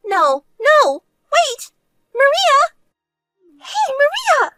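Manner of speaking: very high, loud panicked cries; desperate calling out. Acted. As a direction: call frantically after someone leaving. Calling after departing sister.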